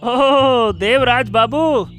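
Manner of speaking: high, loud, drawn-out hello; surprised greeting. Acted. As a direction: greet him in pleased recognition. Madhav greets the man calling. (चौंककर अभिवादन)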